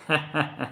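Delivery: short run of giggles; soft acted laughter; amused giggle. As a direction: giggle at an absurd claim. Child laughs at real tail.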